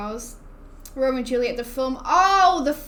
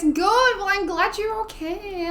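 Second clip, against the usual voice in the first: bright reply; 8 semitones higher, 4 dB louder than usual. Real, not acted. Cheery answer to chat.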